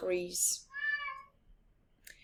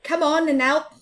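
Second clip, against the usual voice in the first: louder, higher brisk command; firm order; 9 semitones higher, 8 dB louder than usual. Acted. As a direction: order him out of the barrow. Big Mouse orders him out.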